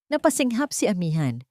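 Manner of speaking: usual speaking voice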